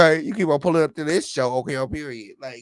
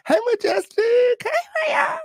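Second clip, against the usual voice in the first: very high shouted greeting; slower than usual. Acted. Host shrieking hello to viewers. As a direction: scream the names up high.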